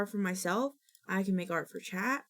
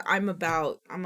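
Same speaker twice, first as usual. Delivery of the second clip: normal talking voice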